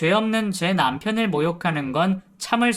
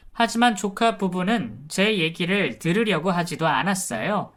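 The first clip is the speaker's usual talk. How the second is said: ordinary delivery, nothing marked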